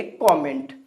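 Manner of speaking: कमेंट said KAW-ment; correct pronunciation. Shown as a demonstration. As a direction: open the first vowel of कमेंट to "aw". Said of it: "तो वहाँ पे क्या बोलेंगे?". (कमेंट को "कॉमेंट" बोलें)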